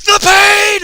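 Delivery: Paul screaming in agony; agonized scream. Retold or imitated. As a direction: scream it out as the pain hits. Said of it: "he goes".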